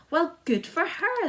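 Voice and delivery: high, loud cheer; cry out. A narrator's reading. Cops cheering for the silkworm, high and loud. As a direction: cheer it out proudly. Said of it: "the cops cried out".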